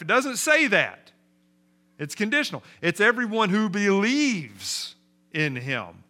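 loud, high, forceful preacher; emphatic preaching. Acted. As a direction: drive the point home to the congregation at full force.

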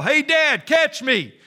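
loud, high shout from above; imitating a child yelling to his father; boy's yell. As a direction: call out to Dad at full voice. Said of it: "heard a voice above him yell".

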